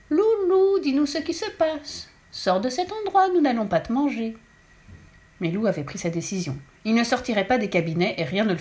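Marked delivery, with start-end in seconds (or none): 0.1-4.4 high, slow, sugary coaxing; sweet coaxing voice. A narrator's reading. Mother coaxing her son out of the toilet, sugary coaxing. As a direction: coax him out in a honeyed voice. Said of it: "a pris une voix toute douce".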